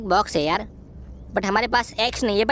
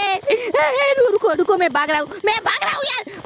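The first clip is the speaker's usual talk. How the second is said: panicked; 10 semitones higher than usual. Real, not acted. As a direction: yelp and scramble away in fright. Chased by grandpa in game.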